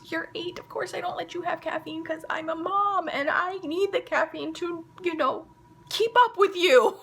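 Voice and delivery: high-pitched, exasperated mom protest; mock exasperation. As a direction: build to a loud, high finish. Acted exasperated mom answering son.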